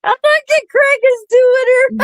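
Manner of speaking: loud and high, thrilled; excited. Real, not acted. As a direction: squeal it out excited. Excited laughing about impression.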